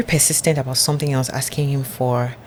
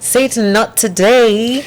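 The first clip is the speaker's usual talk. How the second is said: high, slow mock-sassy refusal; defiant rebuke; 7 semitones higher, 4 dB louder, slower than usual. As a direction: draw out today like you're shutting the devil down.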